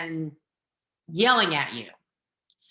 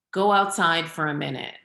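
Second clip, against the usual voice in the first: usual speaking voice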